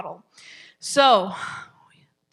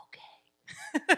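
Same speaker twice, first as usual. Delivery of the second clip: tiny high quiet "okay"; meek little squeak, imitating a kid; mimicked child reply; 6 dB quieter than usual. Coach imitates a child giving in, small high voice. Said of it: "And they're like".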